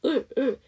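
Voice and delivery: short dark L sound, "을" twice; muted vowel. Shown as a demonstration. Teacher isolates the contraction's ending, short "을, 을". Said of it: "더 모음을 묵음처럼".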